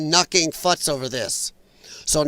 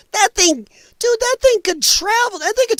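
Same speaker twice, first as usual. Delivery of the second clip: much higher, incredulous "dude"; amazed disbelief; 14 semitones higher than usual. Real, not acted. Streamer reacting to drone speed with high disbelief.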